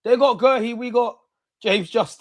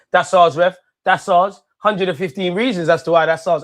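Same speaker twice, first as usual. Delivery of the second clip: shouting at the ref; 4 semitones higher, 6 dB louder than usual. Real, not acted. Appealing to the referee.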